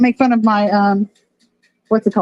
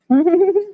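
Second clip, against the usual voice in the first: startled laugh; 7 semitones higher than usual. Real, not acted. Fish nibbling her hand.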